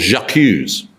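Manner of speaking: J'accuse said the French way, zhah-KOOZ; lightly French-accented J'accuse. Shown as a demonstration. Teacher naming Zola's article, in a light French accent. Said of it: "I hope you like that French accent".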